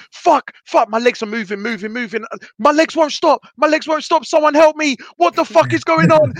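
high frantic pleading; high frantic cries; panicked. Retold or imitated. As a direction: beg for help as the legs keep moving. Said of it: "I'm just like".

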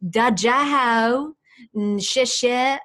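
put-on loud, broad American-accented Mandarin; hammed-up American accent on Mandarin words; American accent in Chinese. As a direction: mimic an American attempting Chinese, tones off. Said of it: "they speak with the American accent".

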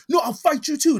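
loud, high mock taunt of a drunk picking a fight; hammed-up drunk bro wanting a fight; drunk challenge. Retelling a shirtless drunk friend challenging the Mexican dude, loud mock taunt. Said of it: "He's like".

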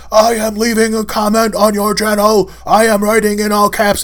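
loud, high-pitched all-caps yell; imitation of someone typing in capitals, yelled; mock shouting. Shown as a demonstration. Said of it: "I read it as shouting".

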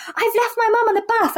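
blurting it out in a panic; panicked quote. Retold or imitated. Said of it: "she said".

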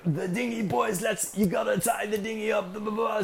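high mock yell of orders; mock skipper Glenn. Skipper Glenn screaming orders at the boys, high mock yell. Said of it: "he screamed at us".

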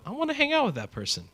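high, airy lovestruck sigh; smitten. Acted. Someone seeing a crush across the room, high and smitten. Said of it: "And you go".

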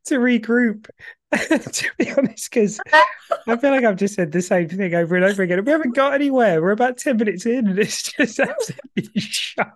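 can't hold the laughter in while talking; losing it laughing. Real, not acted. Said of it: "otherwise I'm gonna lose it".

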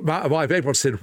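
normal talking voice

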